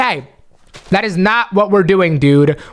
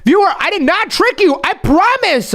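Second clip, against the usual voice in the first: high, insistent protest; pleading; 7 semitones higher than usual. Acted. Host denies tricking the hurt viewer, pleading. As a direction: insist earnestly on your innocence.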